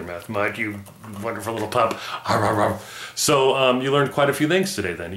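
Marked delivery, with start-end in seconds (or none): 2.3-2.8 puppet barks. Acted.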